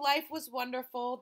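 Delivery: ordinary delivery, nothing marked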